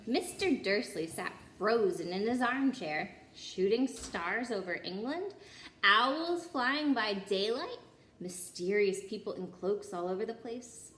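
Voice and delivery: plain reading voice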